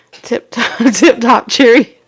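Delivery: lightly put-on English accent with a laugh; playful English accent. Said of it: "that's the accent".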